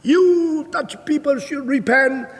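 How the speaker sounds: high, slow translated proclamation; interpreter's preaching call. Retold or imitated. Interpreter relays sermon to the Dutch, high slow call. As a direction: imitate an interpreter echoing the preacher's cry. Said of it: "Terus diterjemahkan".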